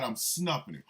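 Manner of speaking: ordinary delivery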